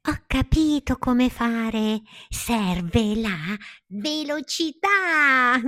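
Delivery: high, loud cry of discovery; excited shout. A narrator's reading. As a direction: shout it out, drawing out the last word. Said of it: "gridò Palmira".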